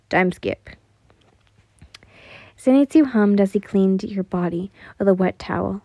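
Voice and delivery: ordinary delivery, nothing marked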